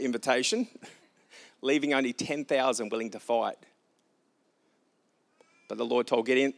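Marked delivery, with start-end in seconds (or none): none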